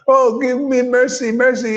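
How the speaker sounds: high, pleading mock plea; whiny begging disciple. Mocking a disciple begging the guru for mercy, high whiny plea. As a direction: ham up a needy follower begging for mercy.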